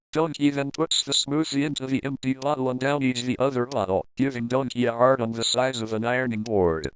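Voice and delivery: plain reading voice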